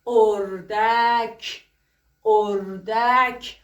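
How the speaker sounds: اردک stretched very slowly, each sound apart; sounding out اردک syllable by syllable for first graders. Shown as a demonstration. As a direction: drag out اردک so children hear the او at the start. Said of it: "مثل".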